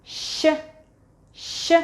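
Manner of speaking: "Sh" hissed softly as a Russian Ш; sound demo. Shown as a demonstration. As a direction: breathe out "Sh" like a Russian Ш. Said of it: "I'm going to point to the sound".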